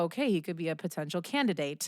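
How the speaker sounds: low, soft aside to herself; private thought. Retold or imitated. Storyteller weighing up the man she just met, quiet aside. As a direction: murmur it as a retold thought.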